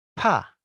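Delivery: pa with a firm fall; fourth tone. Shown as a demonstration. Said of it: "Nada empat ya".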